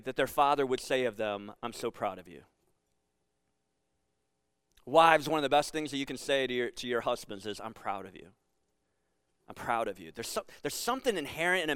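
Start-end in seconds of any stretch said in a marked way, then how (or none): 1.6-2.4 soft tender whisper. Shown as a demonstration. What men hope father says. 7.6-10.1 soft tender. Shown as a demonstration. Wife speaking to husband.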